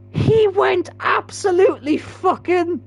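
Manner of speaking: slow high squeal breaking into laughter; helpless laughing. Real, not acted. Gamer describing the player's slide, helpless high laughing. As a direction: try to speak, then collapse laughing. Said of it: "from laughing at that".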